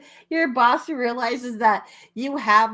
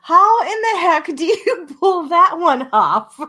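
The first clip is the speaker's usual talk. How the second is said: louder, slow question broken by laughter; laughing disbelief; 6 semitones higher, 9 dB louder than usual. Real, not acted. To her co-host about the smuggled bird, laughing disbelief.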